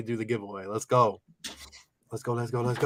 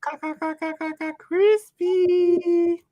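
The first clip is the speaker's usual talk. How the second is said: silly catchphrase voice; 13 semitones higher, slower than usual. Pretend. Repeats catchphrase.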